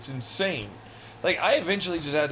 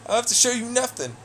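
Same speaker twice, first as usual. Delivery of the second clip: higher and fast, a sneering parrot of the line; mocking repeat; 8 semitones higher, faster than usual. Echoing the other person's refusal back at them in mockery. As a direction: throw his own words back at him in a taunt. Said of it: "You're right, you don't".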